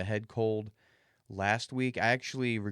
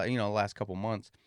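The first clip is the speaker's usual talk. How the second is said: ordinary talk, nothing marked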